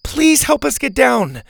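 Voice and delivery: high, urgent begging; pleading call from up high; shouted plea. Acted. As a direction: beg loudly for rescue. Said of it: "Bo shouted".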